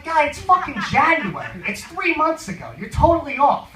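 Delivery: acted-out disbelief at a clueless stranger; retold exasperated correction.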